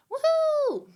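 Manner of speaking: high, squealing woohoo of joy; excited cheer. Real, not acted. Cheering a chat member's win, a high excited woohoo.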